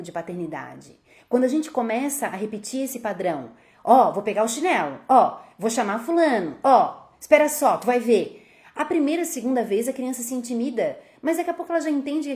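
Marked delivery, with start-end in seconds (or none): 3.8-8.4 louder, rising empty threats; threatening parent. Retold or imitated. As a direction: warn the child with a raised voice. Pais ameaçam com chinelo. (ameaça dos pais em voz alta)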